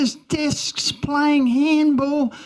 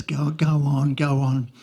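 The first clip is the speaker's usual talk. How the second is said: lightly voiced, lower, dragging old pastor; a hint of a bored pastor waving her on, sinking lower; weary pastor; 9 semitones lower than usual. Pastor letting the girl speak, low and weary.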